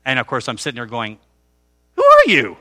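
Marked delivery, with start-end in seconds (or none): 1.9-2.6 high, loud incredulous yelp; startled. Retold or imitated. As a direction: blurt it out in shock. Startled thought at stranger's question.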